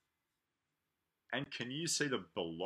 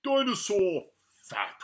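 big loud; 3 semitones higher, 7 dB louder than usual. Acted. Reading fat page.

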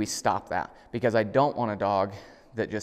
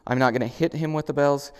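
ordinary delivery

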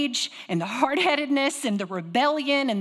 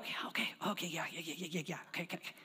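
meek whispered yeses; 4 semitones lower, 15 dB quieter, faster than usual. Retold or imitated. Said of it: "They will go".

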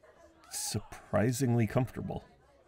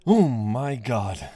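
startled gasp; 5 dB louder, slower than usual. Acted. Startled finding someone in bathtub.

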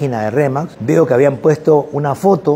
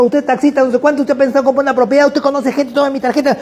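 put-on high, rushed, overeager young salesman; a much higher, breathless eager voice; 9 semitones higher than usual. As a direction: pitch the shared taxi like a pushy go-getter. Student pitches to the taxi driver.

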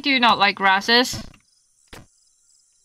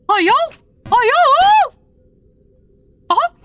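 much higher, fast cartoonish squeak; squeaky put-on voice; 12 semitones higher, 3 dB louder, faster than usual. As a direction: chatter like a panicked little cartoon character. Squeaky put-on voice while playing.

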